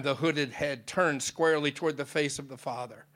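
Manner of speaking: normal talking voice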